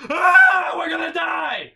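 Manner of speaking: high, loud panicked yell; new driver screaming as mom jerks the wheel; terrified scream. Retold or imitated. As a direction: scream like you're about to crash.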